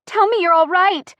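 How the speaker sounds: loud, high, desperate plea; voice cracking. A narrator's reading. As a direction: beg for reassurance at the top of your voice. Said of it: "My voice cracks".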